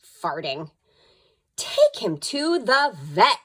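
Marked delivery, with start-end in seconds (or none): 1.5-3.4 father's voice, higher and louder, slow; stern Father. A narrator's reading. Father ordering the dog to the vet, slow and firm. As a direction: lay down the law slowly. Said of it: "said Father".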